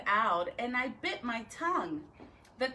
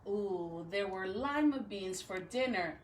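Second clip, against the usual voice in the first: plain reading voice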